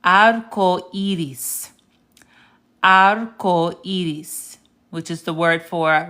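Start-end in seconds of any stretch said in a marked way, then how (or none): 0.0-4.6 "Arco iris" drawn out slowly, vowel by vowel; slow, careful Spanish "Arco iris" with pure vowels; sounding out. Shown as a demonstration. Teacher sounds out an example for learners, slow deliberate "Arco iris". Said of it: "let's sound this one out".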